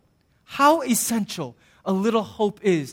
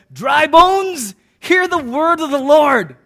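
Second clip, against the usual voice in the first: louder and higher, slow and grand; booming proclamation; 4 semitones higher, 4 dB louder, slower than usual. Acted. Preacher voicing Ezekiel calling out to dry bones, booming. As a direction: call out to the bones like a prophet.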